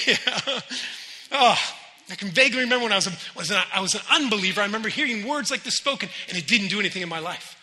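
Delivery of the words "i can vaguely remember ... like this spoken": usual speaking voice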